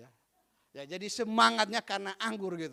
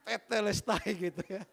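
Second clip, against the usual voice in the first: laughing. Real, not acted. Preacher explaining the joke's punchline while laughing. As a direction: chuckle out the punchline word.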